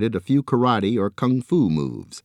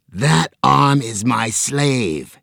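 slow, louder menacing monster voice; squid hiss; 5 semitones higher, 5 dB louder, much slower than usual. A narrator's reading. Squid monster claiming its slave, slow and menacing. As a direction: hiss it out like a sea beast. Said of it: "hissed the squid".

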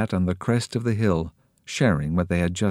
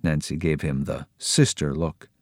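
ordinary delivery, nothing marked

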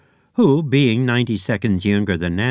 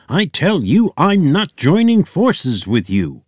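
pig refusing; 7 semitones higher, 5 dB louder than usual. A narrator's reading. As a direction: hammer each word of the refusal.

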